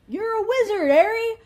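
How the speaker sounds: high, loud, booming proclamation of the famous line; dramatic quote. Retold or imitated. Reviewer mocking the trope, hammed-up loud declaration. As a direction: announce it like a grand movie reveal.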